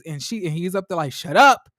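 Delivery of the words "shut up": loud, high mock snap; angry outburst. Man shutting up the woman going on and on, angry outburst. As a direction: cut her off with a sudden acted yell. Acting out Derek snapping at her.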